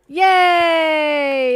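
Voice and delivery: loud, high little cheer of delight; a bright celebratory yay when it finally works; excited cheer. Real, not acted.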